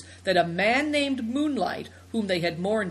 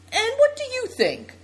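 put-on girl character voice; 5 dB louder than usual.

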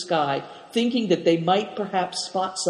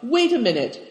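raised higher call; cried out; 7 semitones higher, faster than usual. A narrator's reading. Chief calls to his men, a raised cry. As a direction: call out to stop them. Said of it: "Dampignac cried".

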